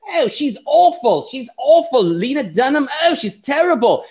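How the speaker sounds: put-on a gossiping British pundit's accent, pitched well up; Milo's British accent. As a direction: sneer it in a camp British voice. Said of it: "the accent sounds more like Sargon than Milo".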